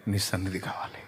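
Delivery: unvoiced, intimate whisper; whispered plea. Believer whispering to the Lord for his presence. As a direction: breathe the longing out softly.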